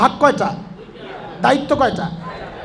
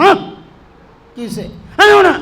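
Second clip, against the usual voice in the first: put-on sulky child; 6 semitones higher, 6 dB louder, much faster than usual. Sulking child refuses hundred taka.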